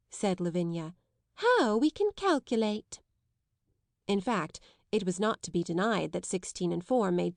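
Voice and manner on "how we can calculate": sarcastic. A narrator's reading. Lavinia's sarcastic remark.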